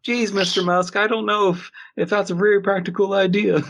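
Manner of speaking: a nervous employee doubting Mr. Musk's idea, high and timid; skeptical underling. Acted. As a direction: play a nervous yes-man fretting to his boss. Skeptical employee answering Musk.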